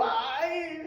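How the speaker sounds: long, soft "ay" with a laugh; reluctant groan. Acted. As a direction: groan playfully at being called on. Acts reluctance when called to pray.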